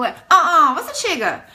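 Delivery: high, loud "uh-uh" with laughing edge; incredulous women. Retold or imitated. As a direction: mimic their shocked chorus, pitched high. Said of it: "Just like that".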